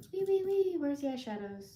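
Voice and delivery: high singsong "Wee, wee, wee"; playful singsong. Real, not acted. Host hums playfully while hunting for eyeshadows. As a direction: sing it out, light and silly.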